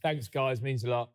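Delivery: low flat unconvincing thanks. Real, not acted. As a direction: say it like you don't mean it. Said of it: "That didn't sound like it".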